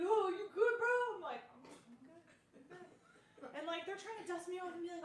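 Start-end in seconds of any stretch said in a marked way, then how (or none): 0.0-1.1 high loud casual bro concern. Retold or imitated. Smokers checking on her. 1.5-3.5 much softer stunned murmur; dazed quiet reply to the smokers; dazed mumble. Retold or imitated. Dazed reply after fall.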